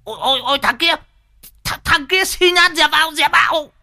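very high, stammering shriek; shrieking in alarm. Acted. Driver sees the beggar in his path, shrieking in alarm. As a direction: yell a frantic warning, voice shot up high. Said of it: "thì rú lên".

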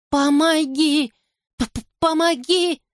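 high panicked plea; terrified stammering cry. Acted.

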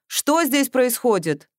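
slow, stern demand from the car. A narrator's reading. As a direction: ask it like a strict man catching someone out. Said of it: "строгий голос спросил".

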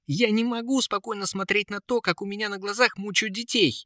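put-on high, impassioned boyish voice; indignant protest. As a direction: plead the children's case heatedly. Prince protests children's torment. (горячий протест)

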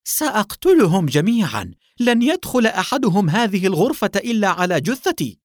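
high, loud vow to kill; furious shouting. A narrator's reading. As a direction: shout it in a towering rage. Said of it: "وهو يصيح وقد تملكه الغضب". (صياح غاضب متوعّد)

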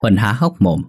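ordinary delivery, nothing marked

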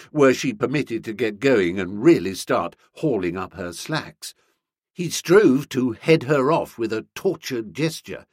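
ordinary delivery, nothing marked

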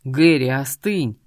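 slow, low, firm order; stern command. Acted. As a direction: rein in an angry brother. Brother restraining enraged dragon.

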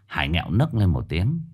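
plain reading voice

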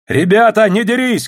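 high call over the fighting; shouted plea. A narrator's reading. Илья stopping his fellow soldiers, high shout. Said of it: "крикнул Илья".

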